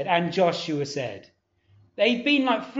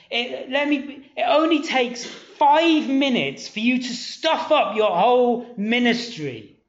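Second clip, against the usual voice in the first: raised, intense preaching voice; preaching at full force; 5 semitones higher than usual. Acted. Preacher warning the congregation against pride, intense. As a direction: drive the warning home with force.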